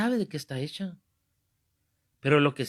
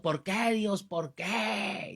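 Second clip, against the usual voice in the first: higher, stretched-out mock wail without much volume; retold anguished cry; 5 semitones higher, 3 dB quieter, much slower than usual. Man clutching his liver cries to God, mock anguished cry. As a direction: imitate a sufferer crying out to God. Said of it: "como aquel que le estaba gritando".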